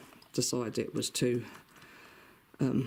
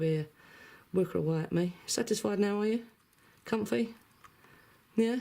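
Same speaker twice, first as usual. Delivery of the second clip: ordinary talk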